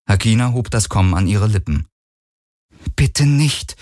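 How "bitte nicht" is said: pleading. A narrator's reading. Kirai silently begging the captain not to tell, pleading. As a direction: beg with a touch of desperation. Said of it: "flehte Kirais Blick".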